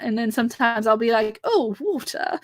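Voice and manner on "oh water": higher, softer, very posh British accent. Shown as a demonstration. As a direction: put on a posh British "water". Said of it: "that's very posh".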